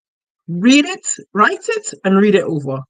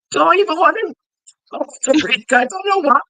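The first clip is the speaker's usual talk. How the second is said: exasperated; 7 semitones higher than usual. Retold or imitated. Retelling exasperated reply to client.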